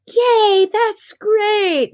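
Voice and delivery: a hammed-up cheerful nurse; mock nurse cheer. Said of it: "how they are with their encouragement".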